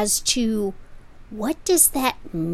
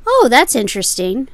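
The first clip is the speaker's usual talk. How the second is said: polite, louder mock interest; feigned interest; 4 semitones higher, 7 dB louder than usual. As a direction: pretend to care about the item. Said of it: "the person at the pawn shop will say".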